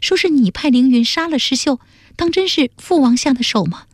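high-pitched daughter's voice; young princess questioning her father. Acted. Daughter asking her father about a killing, high anxious questioning. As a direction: ask in a high, earnest daughter's voice.

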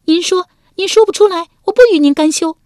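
high, loud, daring him; furious demand. A narrator's reading. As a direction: shout the challenge at her husband. (文姜高声怒逼)

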